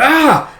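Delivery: high, loud yelp of pain; wounded dragon crying out. Acted. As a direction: cry out as the arrow hits. Dragon hit by arrow.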